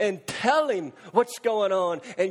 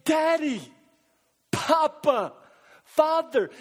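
higher, louder pleading cry of Daddy, Papa, Father; crying out to God; 6 semitones higher, 4 dB louder, slower than usual. Shown as a demonstration. Preacher showing the church how to cry out, pleading. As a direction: call out like a child to a father. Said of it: "but Paul says, say".

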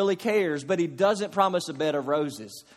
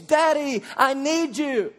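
high, loud plea; child's desperate shout; desperate cry; 7 semitones higher, 3 dB louder, slower than usual. Retold or imitated. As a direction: scream it out for help. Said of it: "screaming out to our daddy for help".

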